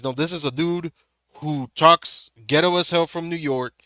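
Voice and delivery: ordinary talk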